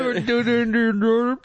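Robin Williams. Acted. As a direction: babble the name in a badly faked comedian voice. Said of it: "your Robin Williams doing Jordan".

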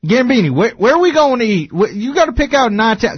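high, stumbling Texan imitation; Boomhauer-like Texas twang; Texan accent. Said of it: "Wow, that's a good accent".

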